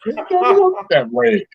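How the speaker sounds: high, fast, flustered babble; dazed fish. Pretend. Fish back in its tank trying to act normal, high and fast. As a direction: rattle it off like a confused little fish. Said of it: "And it's like".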